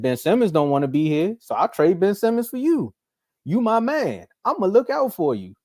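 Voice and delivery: high, lightly voiced Daryl Morey being loyal; mock Morey. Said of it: "Daryl Morey said".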